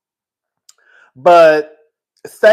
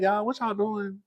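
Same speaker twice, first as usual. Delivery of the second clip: coming back down; 11 dB quieter, slower than usual. Shown as a demonstration. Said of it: "then I come back down".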